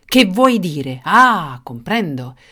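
loud angry demand; tyrant's loud outburst; furious. Acted. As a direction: demand an explanation, incensed. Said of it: "esclamò il furioso il tiranno".

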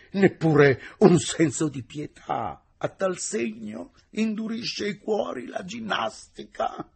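high, slow, theatrical questioning; incredulous reproach. A narrator's reading. Engineer reproaches the teacher for no pity, dismayed. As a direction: protest with drawn-out, high-pitched dismay.

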